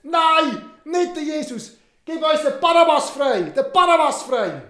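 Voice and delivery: high, loud angry yelling; crowd yelling. Acted. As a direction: shout down the governor. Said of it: "angefangen zu schreien".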